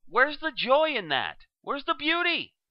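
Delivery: high, slow and grandly theatrical; grand retort. Retold or imitated. Villain retorting to interviewers, high and theatrical. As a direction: fling it out like a lofty riddle. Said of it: "And I say".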